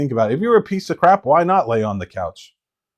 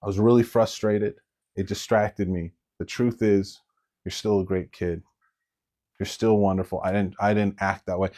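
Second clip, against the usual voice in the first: soft, lowered apology; apologetic mother; 4 semitones lower, 6 dB quieter than usual. Acted. Mother pulling her child aside after snapping, soft apology. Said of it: "pull you aside later and say".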